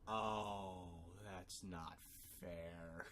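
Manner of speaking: soft, low, defeated grumble; quiet sulky complaint; sulky whine. Retold or imitated. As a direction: mutter like a sore loser. Mixie loses, whining.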